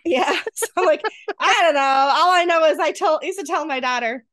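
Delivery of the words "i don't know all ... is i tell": ordinary delivery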